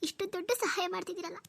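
high, broken tearful thanks; tearful gratitude. Acted. Sister moved that a non-relative helps them, crying. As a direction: cry your thanks in a high voice, phrase by phrase. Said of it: "ನೀನ್ ಸುಮ್ನಿರು, ಅಳ್ಬೇಡ".

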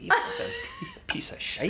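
playful Scottish roast voice; mock Scottish insult. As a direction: fake Scottish heckle, laughing through it. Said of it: "Especially with that accent".

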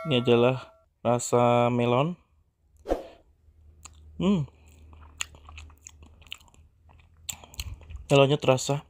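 chewing while talking; muffled through food; mouth full. Real, not acted. Seen 0: bites green cake at -4, lips closed chewing through labelled words.

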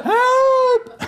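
high, loud cry for help; praying for help from the bottom of the pit; desperate cry for help. Shown as a demonstration. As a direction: yell it like you mean it. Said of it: "And you gotta mean it".

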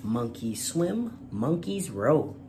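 usual speaking voice

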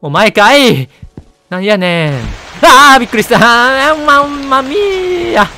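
loud, high jump-scare yell; yelp, then laugh it off; startled outburst. Real, not acted. Player jumped by a mummy, loud startled yell.

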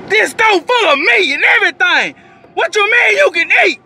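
can't believe what he's hearing; incredulous high shout. Real, not acted. Telling viewers about cousin, incredulous high shout. Outraged retelling of cousin's question.

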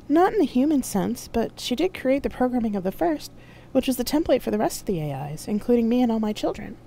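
high-pitched, explanatory AI voice; AI companion Loki. A narrator's reading. AI explains its creator to Carol, in a high voice. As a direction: keep it high throughout, an AI explaining its origins.

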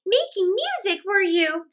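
high scornful ants; mocking cry. Ants mock the grasshopper for playing all summer, high and scornful. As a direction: sneer at him from above. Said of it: "they cried".

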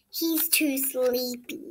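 hushed sleepy. Acted. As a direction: drop it down as if not to wake him.

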